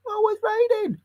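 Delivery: high squeaky repeat. Pretend. Vlogger mocking the rain in a high squeaky voice.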